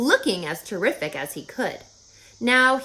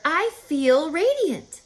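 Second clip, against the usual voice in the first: pig's voice; 8 semitones higher, 4 dB louder, slower than usual. Acted. Wilbur voiced proudly.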